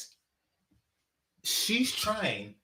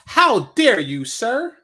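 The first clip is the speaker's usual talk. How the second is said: mocking outraged woman; 4 semitones higher, 8 dB louder, much slower than usual. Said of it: "mocking the woman".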